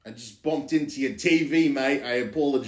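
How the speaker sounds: put-on Cockney apology; comic London accent. Shown as a demonstration. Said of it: "this is my Cockney accent".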